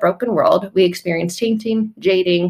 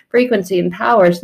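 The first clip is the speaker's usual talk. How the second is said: normal talking voice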